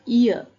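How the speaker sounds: "Ear" said loudly as ee-uh; ear sound demo. Shown as a demonstration. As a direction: model "Ear" as one gliding sound. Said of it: "the sound we learn here".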